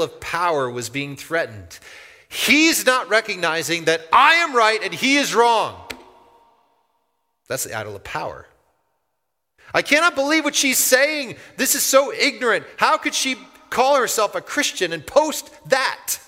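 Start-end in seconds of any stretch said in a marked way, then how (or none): 2.3-5.9 high, loud self-righteous rant; hammed-up righteous anger; mock indignation. Voicing the self-righteous angry poster. 9.7-16.2 high, loud indignant disbelief; hammed-up shocked outrage; mock outrage.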